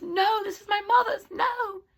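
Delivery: high, wailing plea; acted distress. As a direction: cry out in hammed-up dismay. Cinderella protests as dress is ripped.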